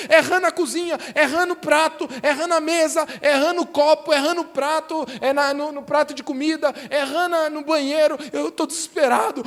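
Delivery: hammed-up desperation, each item another complaint; desperate Pharaoh. Pharaoh begging Moses to end the frogs, acted desperation. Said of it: "E aí, desesperadamente, o faraó".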